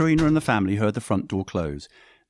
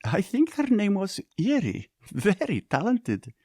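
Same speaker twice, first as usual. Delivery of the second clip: giggled; 12 semitones higher, slower than usual. Acted. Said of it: "Yekaterina giggled".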